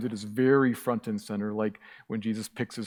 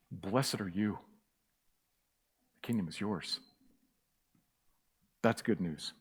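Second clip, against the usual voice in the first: lower, much softer, tender benediction; soft blessing; 4 semitones lower, 8 dB quieter than usual. Real, not acted. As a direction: speak it gently like a blessing over them. Quiet blessing after climax.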